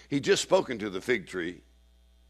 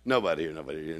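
ordinary delivery

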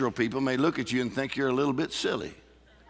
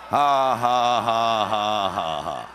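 demonstrative laugh; 4 semitones lower, much slower than usual. Shown as a demonstration. Preacher showing the congregation how to laugh at the devil, forced laugh. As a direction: force a big deliberate laugh, falling at the end. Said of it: "look the devil in the face and laugh".

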